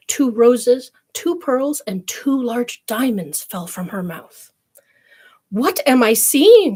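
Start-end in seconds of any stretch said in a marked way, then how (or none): 5.5-6.8 astonished cry, louder and higher, slow; mother's cry. Acted. Mother amazed by pearls from her daughter's mouth, crying out. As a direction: cry out in disbelief. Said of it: "cried her mother".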